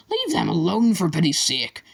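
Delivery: head pleading with the attacker to stop; crying out. A narrator's reading. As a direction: cry it out in alarm. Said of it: "cried Doctor Dart".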